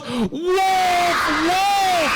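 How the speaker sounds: high, drawn-out cry of wolf; long, sustained high yell; screaming alarm. Acted. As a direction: holler it from a mountaintop. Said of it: "he screams at the top of his lungs".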